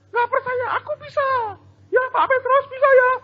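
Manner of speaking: put-on very high, near-tearful kid pleading; insistent child. Child insisting to doubters, high near-tearful plea. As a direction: insist like a child close to crying. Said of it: "Anda berkata gini".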